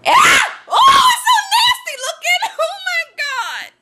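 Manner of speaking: very high panicked squeal; shriek, then wail in revulsion; real squealing bug panic; disgusted scream. Real, not acted.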